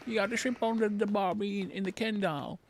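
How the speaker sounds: put-on loud, high, purposely awful Australian accent; mocking Australian accent, fading as it goes; horrible Australian accent. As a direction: butcher an Aussie accent on purpose. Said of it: "I'm doing a purposely horrible accent".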